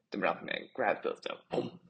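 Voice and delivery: ordinary delivery, nothing marked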